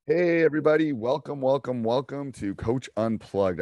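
bright, welcoming host greeting; warm welcoming host. Real, not acted. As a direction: welcome listeners in warmly. Host opens the show.

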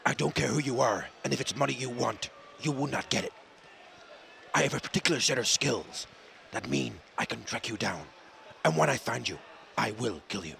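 low, soft film threat; quietly menacing action hero, measured pauses; Liam Neeson. Acted. Father warning a kidnapper, low quiet menace. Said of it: "Liam Neeson in it".

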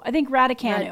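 normal talking voice

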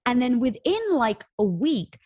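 normal talking voice

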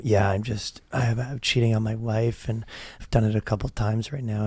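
low, soft penitent murmur; hushed confession. Acted. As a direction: confess it quietly, under your voice. Acts man murmuring in confessional.

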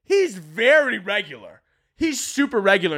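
high, hammed-up mock outrage; mocking the crowd. Comic mocking people calling Van Gogh crazy, high mock outrage. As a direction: squeal it like gossiping critics. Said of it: "And everybody's like".

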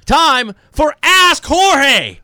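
slow, drawn-out segment intro; announcer voice. Acted. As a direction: stretch it like a big announcement. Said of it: "It is time".

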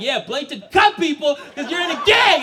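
acted worked-up outburst; heated accusation. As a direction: accuse him, hammed up.

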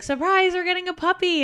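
high, loud retold surprise reveal; spring the surprise with big excitement; acting out a gleeful reveal; excited announcement.

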